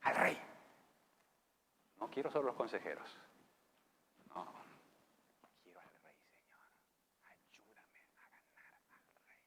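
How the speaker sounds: whispered plea to the Lord; hushed prayer. Acted. Daniel whispering his prayer to God for the king. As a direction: pray it under the breath.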